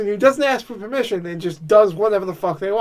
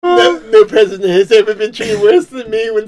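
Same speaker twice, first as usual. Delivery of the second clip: a light Trump voice, rising higher as laughter breaks in; Trump impression; 5 semitones higher, 4 dB louder than usual. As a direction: play Trump whining about Sarah. Voices Trump after friend's story.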